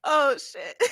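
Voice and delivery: high, helpless laughter breaking up the words; crying with laughter; laughing hard. Real, not acted. Streamer cracking up at moderators yelling in chat, laughing hard.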